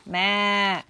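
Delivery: loud, held goat bleat; two long goat calls; goat's bleat. Acted. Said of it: "said the goat".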